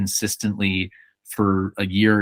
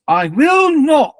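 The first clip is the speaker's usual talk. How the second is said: loud, high, drawn-out defiant cry; persecuted Anglican minister; 7 dB louder, slower than usual. Retold or imitated. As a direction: overact the one big line, slow and very high. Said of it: "as a persecuted Anglican minister".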